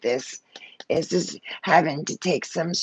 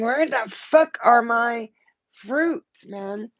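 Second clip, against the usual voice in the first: mock frustration; 7 semitones higher, slower than usual. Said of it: "looking down going".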